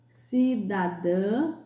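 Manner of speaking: cidadã very slow, final ã nasal and long; very slow teacher demo of cidadã. Shown as a demonstration. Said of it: "Com som ã e ão".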